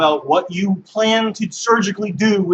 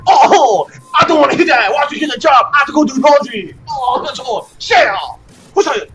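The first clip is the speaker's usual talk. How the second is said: higher, louder, impatient mock rant; brushing-off shout; 6 semitones higher, 5 dB louder than usual. Comic telling the crowd how the old man snaps back, loud and impatient. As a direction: bark it like you're waving them off. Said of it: "He's gonna go".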